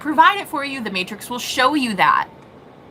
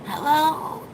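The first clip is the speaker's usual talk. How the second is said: higher, slow, half-tired mom; sleepy mom voice; 6 semitones higher, 4 dB quieter, slower than usual. Retold or imitated. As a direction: imitate a drowsy, caring mother on the phone. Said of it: "she was like".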